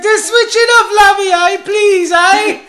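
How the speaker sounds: high, loud desperate pleading yell; mock screaming. Electrocuted fitter pleading with old woman, high hammed-up screaming. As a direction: beg at a comic scream. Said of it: "he's, like, screaming".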